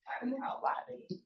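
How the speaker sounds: breathy secret, half to herself; whispered aside. Real, not acted. Professor whispering aside to class about Southern speech, whispered. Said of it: "aside about Southern speech".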